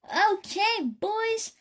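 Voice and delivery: high, loud shout rising to a yell. Acted. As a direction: fire up the band, full volume. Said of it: "she shouted".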